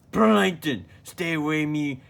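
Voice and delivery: high, loud cry of a name; alarmed shout. Acted. Crab spotting his enemy and crying out his name, high and loud. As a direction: yell the name in shock.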